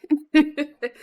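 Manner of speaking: short high nervous laugh. Real, not acted. Laughs between the two refusals.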